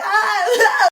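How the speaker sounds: high loud panicked "ah"; frozen scared squeak; startled yelp. Pretend. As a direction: yelp in alarm. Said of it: "I would just be like".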